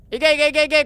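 rapid eager chant of go, urging it on; excited urging. Real, not acted. Urging the toy hand on, eager chant. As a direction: egg the grabber on like a fan. Cheering the grab on.

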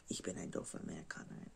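fast whispered self-mockery; whispering. Real, not acted. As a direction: whisper it quickly to yourself. Self-mocking whispered aside.

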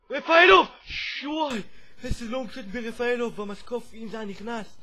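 name yelled high and very loud; astonished shout. Real, not acted. Gamer reacting to Refaelov's long shot off the post, shouting amazed.

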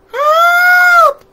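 high, very loud held shout; hammed-up scream for help; panicked cry for help. A narrator's reading. Townspeople running from the monster in the streets, high panicked yell. People flee the monster in story.

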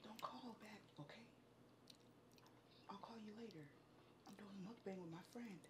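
hushed whisper into the phone. Real, not acted. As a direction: whisper so nobody overhears. Hushed phone call, partner nearby.

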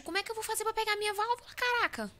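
high, fast panicked talk. Real, not acted. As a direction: rush it out in a panic. Panicked at monster.

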